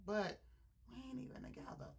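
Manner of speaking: hushed, fading retold line to herself; retold whisper. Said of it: "she whispered to herself".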